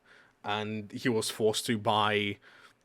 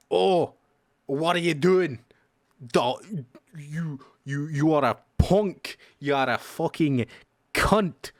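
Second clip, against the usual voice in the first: loud, rough makeshift Scottish accent; shitty Scottish accent; 4 semitones higher, 5 dB louder, slower than usual. Shown as a demonstration. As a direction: bark it in a bad Scottish accent. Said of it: "kind of like a makeshift, shitty Scottish accent".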